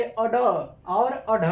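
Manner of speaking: अड और अढ drawn out very slowly, each sound held; slow modeled अड और अढ; pronunciation demo. Shown as a demonstration. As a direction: model अड और अढ slowly for students to copy. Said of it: "तो अब ये क्या हो गए?".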